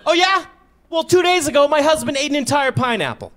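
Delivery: high, loud, huffy comeback; mock indignant woman. Angry shopper defending her watermelon, high indignant shout. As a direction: fire back like an offended shopper.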